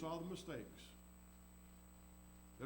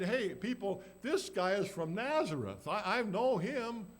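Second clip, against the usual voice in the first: loud, slow, higher accusation to the crowd; calling out; 5 semitones higher, 6 dB louder, slower than usual. Acted. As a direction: announce it to everyone. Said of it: "And she said".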